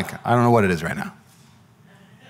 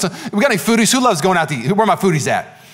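ordinary talk

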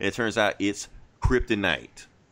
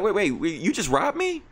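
high-pitched, disbelieving question; incredulous protest; 11 semitones higher than usual. Retold or imitated. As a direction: act out a robbed victim's shocked objection. Retold robbery victim protesting to Batman.

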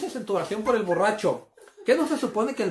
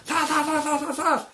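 higher, punchy zas zas zas; impact sound effects; 8 semitones higher, slower than usual. Retold or imitated. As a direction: bang out each zas like a rock hitting tin. Throwing blocks at tin roof.

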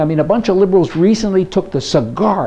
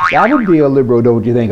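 usual speaking voice

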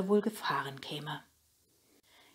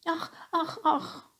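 high sighing ach ach; child sighing sadly; 12 semitones higher, slower than usual. A narrator's reading. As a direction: sigh it like a sad little girl. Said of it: "seufzte Nele".

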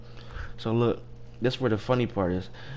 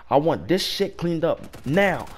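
slow, higher-pitched demand; retold boss's order; 6 semitones higher, slower than usual. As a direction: lay down the order, imitating an irritated boss.

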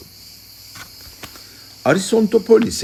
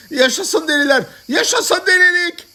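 very high-pitched chanted cheer; rallying shout; 3 dB louder than usual. Acted. Madmen who have seized the radio, shouting in triumph. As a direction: cry it out like a slogan.

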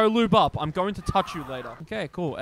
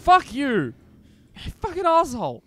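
startled angry outburst; 7 semitones higher than usual. Real, not acted. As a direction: curse at what just scared you. Startled by a jump scare.

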